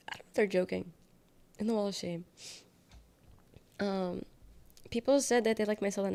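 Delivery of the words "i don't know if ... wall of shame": usual speaking voice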